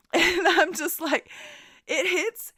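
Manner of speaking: high, loud laughing disbelief; laughing through the words, slow. Real, not acted. Retelling the win.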